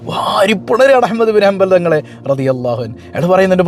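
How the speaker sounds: usual speaking voice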